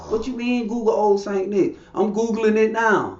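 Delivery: high-pitched, challenging retort; mock indignant. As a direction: imitate someone taken aback and arguing back. Acted indignant reply in Christmas argument.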